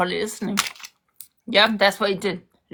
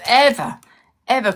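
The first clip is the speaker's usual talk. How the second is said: angry shout; 5 semitones higher, 6 dB louder than usual. Real, not acted. To her ex on TikTok, shouting ever in anger. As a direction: shout ever high and loud in real fury. Furious rant at ex, repeating ever.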